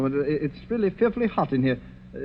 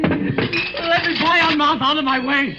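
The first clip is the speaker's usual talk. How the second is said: high, desperate and drawn out at the start; pushing past a ghost in blind panic; terrified scream to be let through; frantic plea; 10 semitones higher, slower than usual. Acted. Guest pleads with the ghost.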